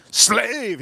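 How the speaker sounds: high, scornful one-word put-down; contemptuous king. Retold or imitated. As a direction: spit it down at him like a mock emperor. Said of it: "he calls him".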